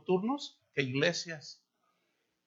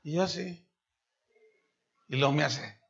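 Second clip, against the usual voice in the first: ordinary delivery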